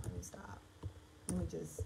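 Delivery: whispered to herself. Real, not acted. Vlogger stops herself mid-edit, whispering. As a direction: whisper the stop to yourself. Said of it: "whispering to herself".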